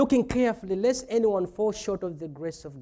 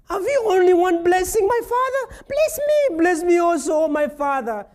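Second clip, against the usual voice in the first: much higher, louder desperate begging; pleading son; 9 semitones higher, 6 dB louder than usual. Retold or imitated. Son begging his father for a blessing, high desperate plea. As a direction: beg like a son who lost everything.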